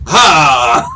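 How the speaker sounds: big loud "ha" on request; repeated startling shout. Acted. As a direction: blurt it out to startle. Do it again.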